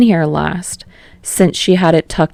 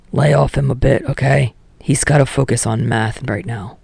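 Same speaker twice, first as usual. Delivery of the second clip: put-on a lower, sullen teen voice; Katsuki's voice; 4 semitones lower, 3 dB quieter than usual. Katsuki brushing off Kirishima, in a lower sullen voice. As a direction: drop lower and grumble it out as Katsuki.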